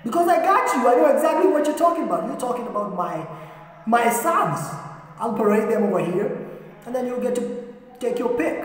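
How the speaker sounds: high, loud burst of fatherly assurance; eager proud father. Acted. Father offering his sons to Samuel, eager and proud. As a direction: jump in high and keen, showing off the boys.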